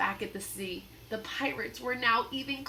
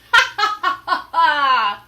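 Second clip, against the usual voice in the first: loud, ugly villain laugh; mean pirate laugh; 6 semitones higher, 15 dB louder than usual. Acted. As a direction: gloat with a nasty laugh. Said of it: "laughed a mean, ugly laugh".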